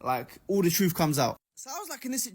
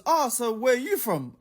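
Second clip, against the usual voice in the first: higher, lightly put-on casual enquiry; mock nosy stranger; 7 semitones higher than usual. Storyteller imitating a stranger opening conversation, mock casual question. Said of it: "one of them goes".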